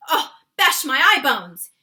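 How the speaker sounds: loud distressed giant's cry. A narrator's reading. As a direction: cry out in frustration. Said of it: "he cried".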